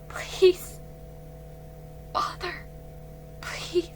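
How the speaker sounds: breathless whispered plea; whispered begging. A narrator's reading. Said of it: "she begged".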